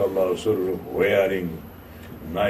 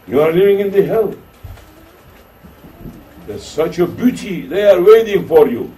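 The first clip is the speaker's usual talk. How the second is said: excited proclamation; 8 semitones higher, 7 dB louder than usual. Retold or imitated. Revived man tells the sheikh.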